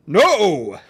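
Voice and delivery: loud, harsh refusal. Acted. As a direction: snap the refusal out. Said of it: "Sir Valentino replied harshly".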